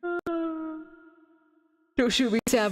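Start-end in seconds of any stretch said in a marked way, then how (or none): none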